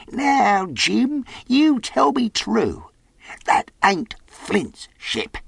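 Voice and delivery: high, slow, suspicious demand; threatening castaway. A narrator's reading. Castaway questions Jim about Flint's ship, threateningly. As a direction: demand the truth slowly, in a high character voice. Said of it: "raised a forefinger threateningly".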